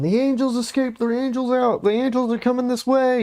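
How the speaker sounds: high, panicked mock yelling; frantic townsfolk shouting, high and shrill; mock panicked screaming. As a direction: cry the alarm like a frantic crowd. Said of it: "everyone's screaming".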